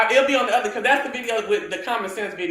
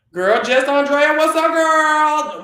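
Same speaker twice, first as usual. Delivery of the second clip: loud, slow, stretched hello; sing-song greeting; 6 semitones higher, 8 dB louder, slower than usual. Real, not acted. Greeting viewer Jess Andrea, loud drawn-out sing-song.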